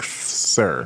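low, breathless whisper of disbelief; whispered sir. Retold or imitated. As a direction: breathe it out, unimpressed. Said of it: "And I was like".

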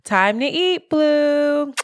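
high sing-song call to a pet; recorded feeder call. Retold or imitated. Owner's recorded message calling her cat, high sing-song call. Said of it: "my voice is recorded on there".

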